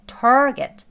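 target drawn out slowly and loud; slow careful target; slow demo. Shown as a demonstration. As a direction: stretch target out for learners. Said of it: "약하게 소리가 나죠".

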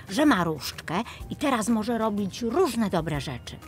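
ordinary delivery

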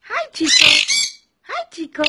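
high sing-song greeting to a parrot; pet talk. Real, not acted. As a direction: coo his name up high like calling a pet. Calling her parrot by name.

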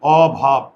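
অভাব in two beats, "aw-bhab"; syllable breakdown. Shown as a demonstration. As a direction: separate the open অ from ভাব in অভাব. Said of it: "syllable ভাঙা শিখো".

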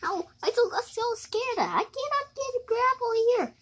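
put-on high, soft scared girl; a lightly voiced frightened girl, high and hushed. Scared girl character who cannot climb up, high and soft. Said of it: "I thought it's the girl voice".

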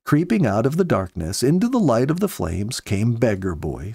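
ordinary delivery

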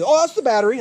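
high, loud mimicked excuse; mock dismissive seller. Seller answering buyer about missing battery, mock dismissive.

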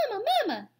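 high little gosling cry; baby gosling. Acted. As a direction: squeak it like a hungry chick. Gosling calling its mother.

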